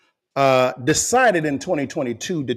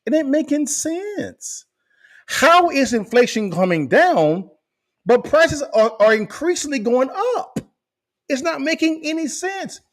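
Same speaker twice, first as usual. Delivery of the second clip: mock exasperated disbelief; 10 semitones higher than usual. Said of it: "Doctor Phil them saying".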